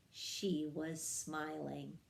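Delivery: plain reading voice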